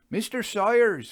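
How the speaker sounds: high, loud shocked call; a terrified fitter bursting in; alarmed cry. A narrator's reading.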